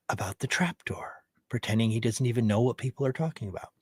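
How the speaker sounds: hushed, suspicious whisper. Real, not acted. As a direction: whisper the accusation like a secret. Whispered accusatory questions.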